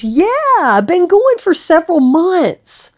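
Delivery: high and loud, beaming; joyful high yes; excited confirmation. Retold or imitated. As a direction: proudly confirm it. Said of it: "And he goes".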